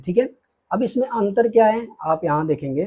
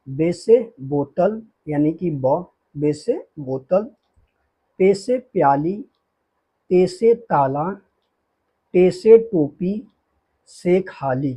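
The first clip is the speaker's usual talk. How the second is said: slow recitation; slower than usual. Shown as a demonstration. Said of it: "teacher reciting letter names slowly".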